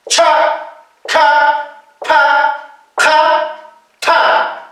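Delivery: "Cha, ka, pa, ka, ta" with a puff of air on each; aspirated consonants. Shown as a demonstration. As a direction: puff out each onset for learners to repeat. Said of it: "consonantes aspiradas". (consonantes aspiradas, con soplo)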